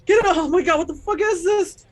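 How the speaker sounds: high, loud terrified shrieks; screaming to get it off; panicked player character screaming. Acted. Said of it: "she starts freaking out".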